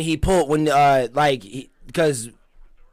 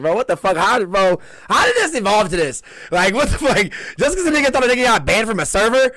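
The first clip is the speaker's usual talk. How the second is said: higher-pitched, exasperated disbelief; can't-believe-it retelling; incredulous outburst; 6 semitones higher than usual. Retold or imitated. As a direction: replay your baffled reaction, voice riding high. Retelling own baffled reaction.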